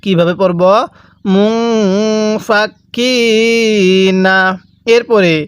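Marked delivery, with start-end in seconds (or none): 1.3-4.4 মুং ফাকিনা with a long hummed n and stretched i; gunnah nasal hold. Shown as a demonstration. Teacher shows learners gunnah, nasal held মুং ফাকিনা. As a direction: hum through the n of মুং, then draw out ফাকিনা. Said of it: "নুন সাকিনটা গুন্নাহ করে পড়ব".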